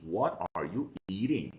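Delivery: ordinary talk, nothing marked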